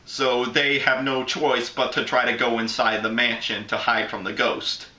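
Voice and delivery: ordinary talk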